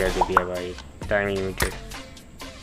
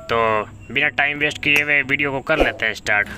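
higher-pitched, punchy host greeting; YouTuber video intro; 5 semitones higher, 4 dB louder than usual. Acted. Host greeting viewers with a new video, high-energy intro.

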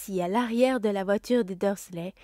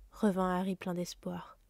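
usual speaking voice

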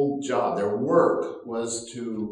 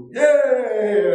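high, loud whoop of a crowd; loud happy yay; cheering; 10 semitones higher, 11 dB louder than usual. Retold or imitated. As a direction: cheer like a whole village. Village cheering.

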